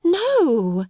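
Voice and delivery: high, eager exclamation; cried out. A narrator's reading. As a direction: cry out to reassure her. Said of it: "cried out Sarah".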